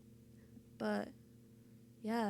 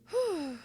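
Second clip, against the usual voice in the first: relieved sigh; 7 semitones higher, 6 dB louder than usual. Real, not acted. Relieved sigh after sad video.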